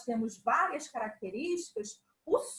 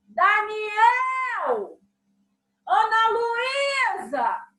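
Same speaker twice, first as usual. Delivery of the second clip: high, loud calls across the house; a mother calling her children from far away; 6 semitones higher, 8 dB louder, much slower than usual. Shown as a demonstration. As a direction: shout the kids' names to call them. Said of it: "quando eu chamo meu filho".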